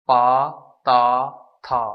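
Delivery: second tone on पा ता था; rising tone. Shown as a demonstration. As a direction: lift each syllable upward, slowly. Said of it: "rising का मतलब है उठाना".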